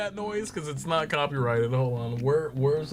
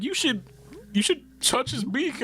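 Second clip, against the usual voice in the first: put-on little girl; 11 semitones higher than usual. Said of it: "and the girl's like".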